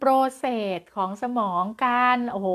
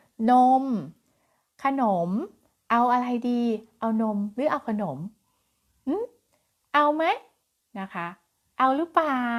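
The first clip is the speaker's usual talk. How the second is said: slow patient offering; 2 semitones higher, much slower than usual. Shown as a demonstration. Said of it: "มือนี้เราต้องถือนมอยู่นะ".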